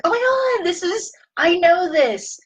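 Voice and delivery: a hammed-up startled brain; mock alarm. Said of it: "and it's like".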